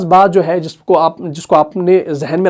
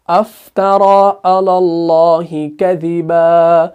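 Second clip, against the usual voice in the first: slow, sustained chant on a level pitch; Quranic recitation; 2 semitones lower, much slower than usual. Shown as a demonstration. Teacher showing how it is read, slow melodic recitation. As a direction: chant it slowly with long held vowels. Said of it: "फिर जब हम पढ़ेंगे".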